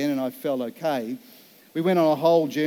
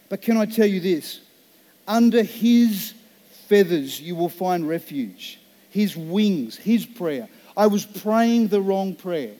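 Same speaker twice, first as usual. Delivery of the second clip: preaching at full force; 3 semitones higher, 4 dB louder, slower than usual. Acted. Pastor to congregation, proclaiming refuge with full force. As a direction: stretch "Under his" out slow, higher and louder.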